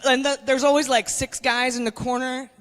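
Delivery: ordinary talk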